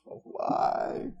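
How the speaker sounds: whispered mock groan of a woken neighbor; a hammed-up sleepy groan. Neighbor woken by morning noise, in a whispered mock groan. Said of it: "Your neighbor's".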